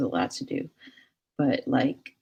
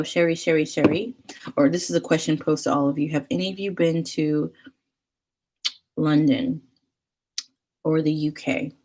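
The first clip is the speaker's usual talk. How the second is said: usual speaking voice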